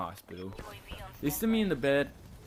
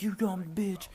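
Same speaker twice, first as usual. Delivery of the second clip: retold cussing out; 8 semitones higher, slower than usual. Hurt passenger to driving girlfriend, mock cussing out. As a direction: re-enact swearing at someone from sudden pain. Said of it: "just cussing her out".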